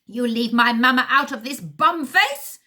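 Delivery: put-on high loud shouting in heavy accent; second henchman shouting, heavy accent. A narrator's reading. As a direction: yell back defending mama. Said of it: "shouts the second man".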